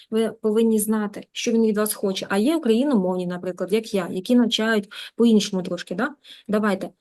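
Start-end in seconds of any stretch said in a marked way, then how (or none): none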